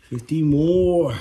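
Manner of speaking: slow, drawn-out hype call; pumped-up hype. Real, not acted. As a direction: psych yourself up for the next set. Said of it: "repeated, psyching up".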